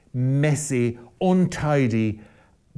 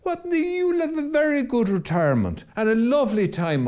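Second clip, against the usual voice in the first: mock cheerful answer; 11 semitones higher than usual. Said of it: "what'll happen then".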